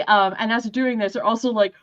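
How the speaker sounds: ordinary delivery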